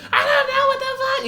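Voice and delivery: imitating drunk arrestees hollering; mock drunk. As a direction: ham up a drunk yelling.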